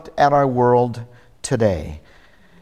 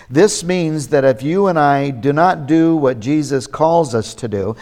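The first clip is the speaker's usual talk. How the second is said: normal talking voice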